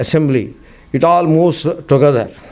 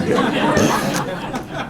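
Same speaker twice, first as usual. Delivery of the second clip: soft laugh; 5 dB quieter than usual. Real, not acted. Laughing at duplicate necklace.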